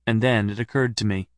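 plain reading voice

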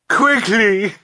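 high, loud cry after a lost friend; anguished high-pitched call; last desperate call. A narrator's reading. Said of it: "Violet called one more time".

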